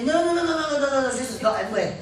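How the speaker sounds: brushing it off too eagerly; mock defensive denial. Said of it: "don't jump and say".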